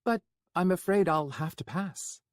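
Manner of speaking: trembling but polite; shaky. Acted. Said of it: "Florian replied shakily".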